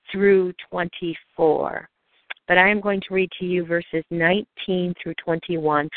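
usual speaking voice

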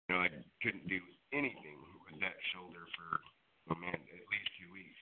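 cutting in and out, faint and distant; fading in and out. Real, not acted. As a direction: voice dropping away on a bad phone line. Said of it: "your voice is so fading in and out".

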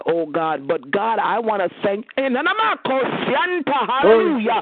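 rising, impassioned prayer voice building toward a climax; fervent prayer. Real, not acted. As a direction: church prayer leader pouring out thanks.

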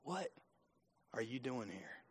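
hushed voice of God. Shown as a demonstration. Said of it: "Can I say what he's doing to you today?".

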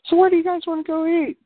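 high, quick put-on mom voice; mom asking. Visiting mom asking where to eat, chirpy high.

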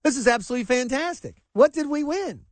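imagined fan, hammed-up joy; mock excitement. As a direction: gush like a fan who thinks we won. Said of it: "what is my first thought".

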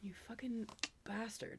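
hushed mock outrage; whispered curse. Co-host blaming the host for derailing them, whispered curse.

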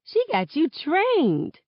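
high, drawn-out teasing jab. Acted. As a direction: rib a friend, stretching it out high. Teasing a friend over doll.

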